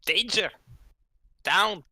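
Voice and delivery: high, loud one-word dragon warnings. Acted. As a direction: shout broken warnings like a clumsy dragon. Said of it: "represent the dragon's voice, and it's gonna be cringy".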